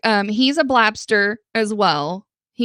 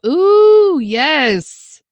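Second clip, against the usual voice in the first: swooning ooh; 7 semitones higher, 7 dB louder than usual. Real, not acted. Swooning over Helen's voice.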